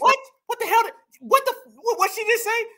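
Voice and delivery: high-pitched, incredulous outburst; baffled disbelief. Real, not acted. Commentator reacting to the clip, stunned disbelief. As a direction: pitch jumps right up, utterly baffled. Baffled by the clip's slurred word.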